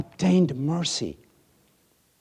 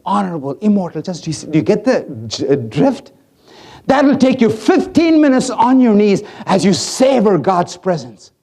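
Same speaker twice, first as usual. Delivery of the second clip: normal talking voice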